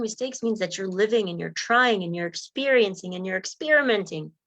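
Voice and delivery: ordinary talk, nothing marked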